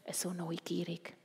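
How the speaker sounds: plain reading voice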